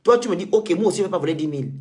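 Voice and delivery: ordinary delivery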